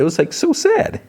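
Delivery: high-pitched "so sad" reaction; moved by sadness. Real, not acted. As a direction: voice shoots up high. Narrator reacts to sad scene.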